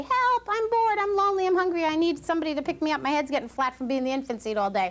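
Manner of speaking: high, loud mock baby whine that settles as it goes; baby's plea. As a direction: voice the baby begging to be picked up. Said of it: "That's how they say".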